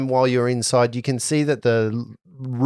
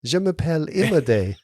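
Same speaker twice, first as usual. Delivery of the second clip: put-on French accent. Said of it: "in a French accent".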